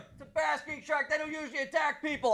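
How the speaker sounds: mock the scared friend in a high dopey voice; buddy's mocking voice. Buddy voiced explaining shark.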